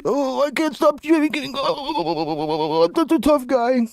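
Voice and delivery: mock trembling tough guy; sarcastic shaky tough guy; adrenaline shakes. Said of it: "Show your face, pussy".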